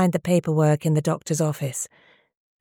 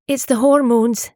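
higher, louder, tearful plea; starting to cry; 7 semitones higher, 5 dB louder than usual. A narrator's reading. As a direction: voice on the edge of tears. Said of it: "starting to cry".

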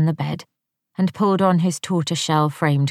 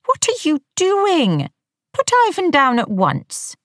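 high, stern wifely reprimand; sharp scolding wife; 12 semitones higher, 3 dB louder than usual. A narrator's reading. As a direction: snap the order at her husband. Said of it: "in a sharp tone".

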